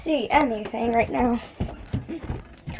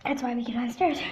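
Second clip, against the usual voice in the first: ordinary talk, nothing marked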